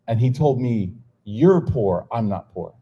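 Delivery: usual speaking voice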